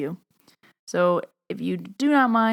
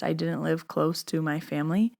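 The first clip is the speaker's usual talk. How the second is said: ordinary talk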